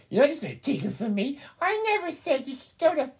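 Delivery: high, whiny mockery of an ex-husband scolding his wife; mocking Cody. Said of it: "he actually had the balls to say that".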